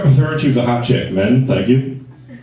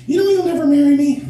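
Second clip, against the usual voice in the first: put-on very high-pitched girlfriend voice; higher voice. Girlfriend in the car about marriage, very high voice. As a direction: squeak it out as the girlfriend. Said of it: "she's a higher voice in the car".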